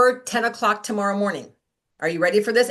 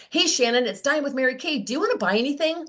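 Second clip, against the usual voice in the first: mock eager, rising sales voice; the blunt pitch she wanted to blurt; pushy sales pitch; 6 semitones higher than usual. Shown as a demonstration. Rep blurting a sales pitch to a customer, overeager. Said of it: "that was what wanted to come out of my mouth".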